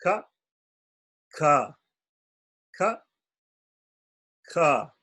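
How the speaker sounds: "Ka" switched between no air and much air; ka and kha alternating. Shown as a demonstration. Teacher alternating ka and kha for contrast. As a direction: alternate the two sounds. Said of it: "that's the difference".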